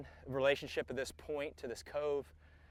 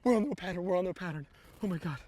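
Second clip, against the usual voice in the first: nervous excitement; 5 semitones higher, much faster than usual. Real, not acted. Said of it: "I'm all nervous".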